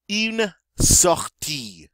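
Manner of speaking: very slow "Une sortie" with a French uvular r; French model pronunciation. Shown as a demonstration. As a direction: stretch "Une sortie" out slowly for learners. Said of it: "And that is how you say".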